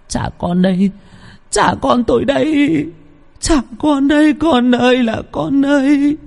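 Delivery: very high, anguished wailing cry. Acted. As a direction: wail in despair for your son. Mother wailing for lost child. (gào khóc thảm thiết)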